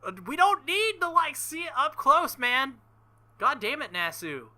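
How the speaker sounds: exasperated, embarrassed outcry, high and loud; exasperated protest. Real, not acted. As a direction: beg the game to stop showing it. Groaning at an explicit scene.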